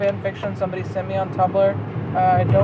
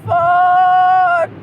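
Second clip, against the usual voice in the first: slow, louder, higher what the fuck; stunned disbelief; 8 semitones higher, 5 dB louder, much slower than usual. Real, not acted. Reader baffled by the story's turn, slow stunned disbelief. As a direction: drag it out, stunned.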